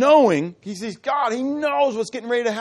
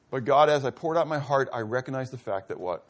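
normal talking voice

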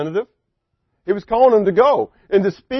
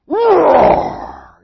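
much higher, loud roaring shout; lion roar; 11 semitones higher, 5 dB louder than usual. Acted. God roaring at Moses like a lion. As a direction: roar like Aslan. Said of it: "you almost picture Aslan".

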